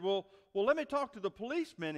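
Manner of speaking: acted boss hurrying to reach an adult; insistent boss.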